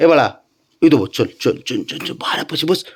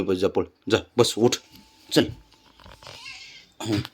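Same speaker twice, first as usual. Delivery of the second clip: ordinary talk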